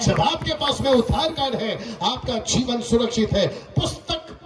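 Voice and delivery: emphatic preaching. Real, not acted. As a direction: declare it deliberately, phrase by phrase. Preaches to the crowd.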